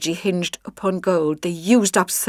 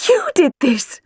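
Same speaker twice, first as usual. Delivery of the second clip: delighted; 7 semitones higher, slower than usual. A narrator's reading. Beaming at Clyde.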